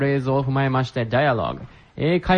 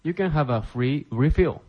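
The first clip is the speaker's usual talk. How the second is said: slow, careful model reading; slow repetition; 3 semitones higher, much slower than usual. Shown as a demonstration. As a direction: spell it out slowly for learners. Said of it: "teacher repeats phrase for learners".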